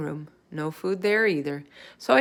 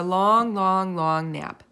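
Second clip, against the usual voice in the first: slow long, long; stretched wish; 4 dB louder, much slower than usual. A narrator's reading. Hamster wishing the baby would nap long.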